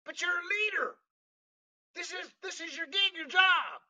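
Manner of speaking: high, incredulous retort; exasperated protest. Retold or imitated. Retells protesting to the leader.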